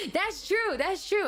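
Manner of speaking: high, laughing agreement; amused agreement. Real, not acted. Streamer agrees with a pasta meme, laughing.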